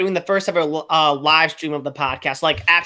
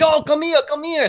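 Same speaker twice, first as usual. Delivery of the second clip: much higher, excited imitation of a welcoming crowd; mock eager beckoning; 9 semitones higher than usual. Russian team inviting a comrade to drink, mock eager beckoning. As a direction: wave a new comrade over, pitched high.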